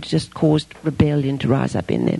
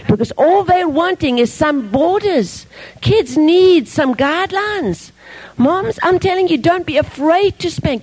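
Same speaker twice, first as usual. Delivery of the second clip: fervent exhortation; 10 semitones higher than usual. Real, not acted. Preacher exhorting moms.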